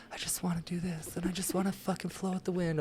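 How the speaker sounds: airy, soft, high whispering; wispy, floaty voice mocking a free spirit; mock dreamy whisper. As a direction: imitate a spaced-out dreamer, whispering. Mocks aimless dreamers.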